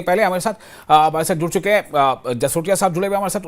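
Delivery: ordinary talk, nothing marked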